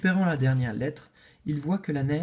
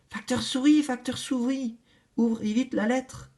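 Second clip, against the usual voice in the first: higher, pressing plea; eager clamor; 7 semitones higher than usual. Acted. Les animaux réclament la lettre, curieux. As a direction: beg him to open it now. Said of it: "réclament les animaux".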